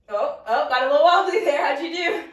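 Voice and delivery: sudden loud, high yelp of surprise; surprised. Real, not acted. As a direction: caught off balance, startled. Wobbling on one leg.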